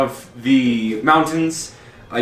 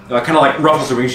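ordinary talk, nothing marked

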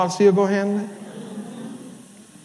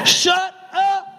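shut up; 7 semitones higher than usual. Acted. Preacher repeating shut up louder.

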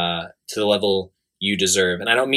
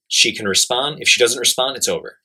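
ordinary talk, nothing marked

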